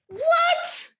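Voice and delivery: squeaky high disbelieving what; stunned, voice shooting up in disbelief; shocked what. Real, not acted. Reacting to a coworker's absurd question, high shocked what.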